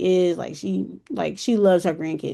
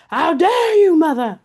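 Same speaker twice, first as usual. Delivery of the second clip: higher, louder, outraged yell at her mother; furious yell; 8 semitones higher, 8 dB louder than usual. Retold or imitated. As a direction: hurl it at your mom in rage. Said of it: "I was yelling and screaming at her".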